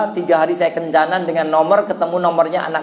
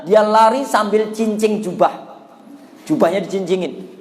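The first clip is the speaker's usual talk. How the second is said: normal talking voice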